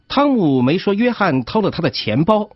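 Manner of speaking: ordinary talk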